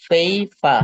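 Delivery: "ফেইফা": paper with breathy p's, no r sound at the end; paper without the r. Shown as a demonstration. Teacher corrects the student's paper: "ফেইফা", no r. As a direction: say "ফেইফা", long ei, r dropped. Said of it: "রটা উচ্চারণ হবে না".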